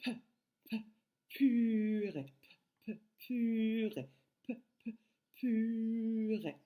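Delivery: two short popped "p" puffs, then a long "puuu-rée"; isolated P sound. Shown as a demonstration. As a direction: pop "p, p" without voice, then stretch "purée". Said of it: "le P qui fait le son p".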